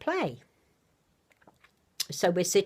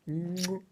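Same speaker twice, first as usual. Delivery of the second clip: soft air kiss; 6 dB quieter than usual. Real, not acted. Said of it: "Big kisses".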